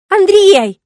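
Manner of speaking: high, loud outcry half in laughter; laughing protest. A narrator's reading. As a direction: scold him while laughing. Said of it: "возмущается она со смехом". (возмущённо, со смехом, громко и высоко)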